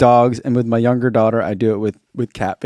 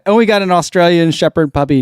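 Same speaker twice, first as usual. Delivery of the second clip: ordinary talk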